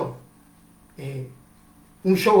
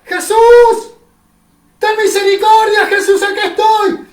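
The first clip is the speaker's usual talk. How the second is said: much higher, much louder scream for mercy; much louder, higher desperate cry of a blind man; 13 semitones higher, 13 dB louder than usual. Acted. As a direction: scream with everything you've got. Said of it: "y de vuelta ... gritaba".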